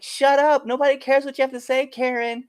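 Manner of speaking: high, loud retold comeback; heckling back. Wrestler snapping back at heckler.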